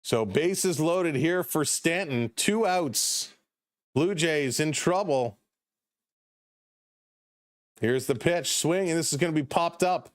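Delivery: live baseball play-by-play, lifted a touch; slow, steady announcer call of a tense inning. Acted. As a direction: call it like a ballgame broadcaster. Calls the game play-by-play.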